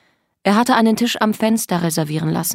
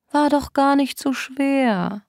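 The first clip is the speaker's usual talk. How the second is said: tender, gentle; 7 semitones higher, slower than usual. A narrator's reading. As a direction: comfort them with unsettling sweetness. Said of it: "Seine Stimme war ... zärtlich und sanft". (zärtlich, sanft säuselnd)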